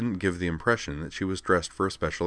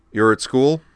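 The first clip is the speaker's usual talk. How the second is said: brisk; 7 semitones higher, 8 dB louder than usual. A narrator's reading. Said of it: "said Griffin more briskly".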